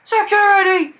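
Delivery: high, loud imitated shout; mock frantic holler; calling for security. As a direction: yell across a crowd for help. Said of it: "I hear".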